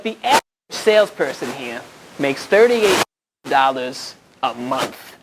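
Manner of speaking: drawn-out Long Island delivery, slowing at the end; put-on thick Long Island voice; Long Island accent. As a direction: imitate a Long Island saleswoman spelling out the pay. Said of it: "thick Long Island accent".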